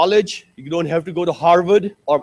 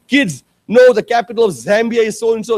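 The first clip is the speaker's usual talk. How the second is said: firm father; 5 semitones higher, 5 dB louder than usual. Retold or imitated. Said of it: "I say".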